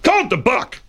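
sudden high-pitched cry; exasperated outburst. Acted. Breaking in on Margaret's spelling, high exasperated outburst. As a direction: burst out high, cutting off the spelling.

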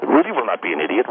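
ordinary talk

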